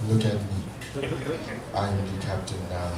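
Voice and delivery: low, quiet, menacing stare; deadpan captain line. Shown as a demonstration. Stranger picked up while smoking, deep and menacing. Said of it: "look them dead in the eyes and go".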